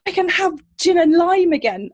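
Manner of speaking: loud, high thrilled announcement; thrilled news. Retold or imitated. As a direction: blurt out the happy news. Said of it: "And she said".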